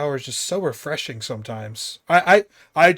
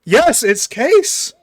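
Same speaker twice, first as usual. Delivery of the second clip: loud, high, drawn-out cheer; excited congratulation; 7 semitones higher, 9 dB louder, much slower than usual. Real, not acted. Game host confirms a correct guess, excited congratulation. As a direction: celebrate it slowly and at full volume.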